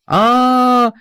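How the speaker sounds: long loud high panicked scream; stoker screaming. A narrator's reading. Stoker screams in terror before crash. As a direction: howl in terror. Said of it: "кричит кочегар".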